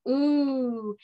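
"Ooh" drawn out, lips rounded; long ooh sound. Shown as a demonstration. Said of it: "O says".

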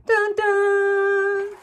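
sung high dun dun sting; mock suspense music, sung high; dramatic sting. Author adding a playful suspense sting, sung high.

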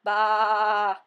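loud goat bleat; sheep bleat. Acted. Classroom full of pet noises.

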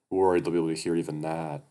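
plain reading voice

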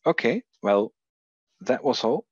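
usual speaking voice

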